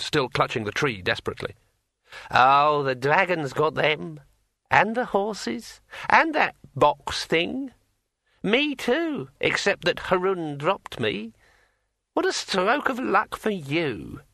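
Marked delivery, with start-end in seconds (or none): 2.3-14.2 high, slow, eerie ringing voice; like a finger round a wine glass rim; ringing metallic sword voice. A narrator's reading. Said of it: "in a voice like the sound of a finger dragged".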